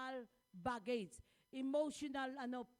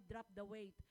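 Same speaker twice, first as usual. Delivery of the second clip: "Drop the weight" softly, lower, almost to himself; quiet repeat; 5 semitones lower, 8 dB quieter than usual. Shown as a demonstration. Pastor echoing scripture softly to congregation, quiet and low. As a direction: let "drop" sink into a soft hush. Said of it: "kaya nga sabi".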